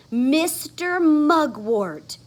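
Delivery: ordinary delivery, nothing marked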